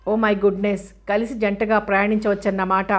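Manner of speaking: ordinary delivery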